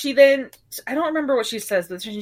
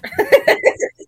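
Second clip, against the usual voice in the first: very high, loud rising laugh; 8 dB louder than usual. Real, not acted. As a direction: crack up at the flubbed name. Laughing at garbled name.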